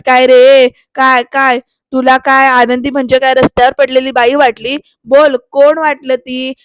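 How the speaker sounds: loud acted scolding with punched questions; angry grilling. Shirke interrogating the peon, loud and angry. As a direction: demand an answer, hard and loud.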